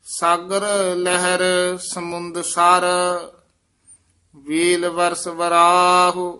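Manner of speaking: slow, measured chant of scripture; recited gurbani line. Shown as a demonstration. Teacher reciting scripture for students, measured chant.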